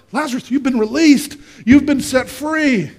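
high-pitched, emphatic appeal; incredulous exclaiming. Acted. Onlooker tells Lazarus he is released, exclaiming appeal.

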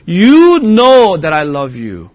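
YOU KNOW drawn out loud, then falling away; slow, heartfelt stress on "You know"; emphatic appeal. Acted. Peter appealing to Christ's omniscience, "You know" loud and stretched. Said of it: "he appeals to Christ's omniscience".

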